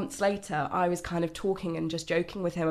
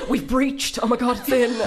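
giddy mock announcement, laughing; excited; 5 semitones higher, 5 dB louder than usual. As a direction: play it as a thrilled little victory cry. Retelling her thoughts at first sex.

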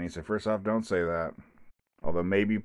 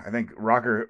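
usual speaking voice

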